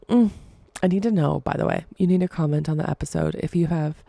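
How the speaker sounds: normal talking voice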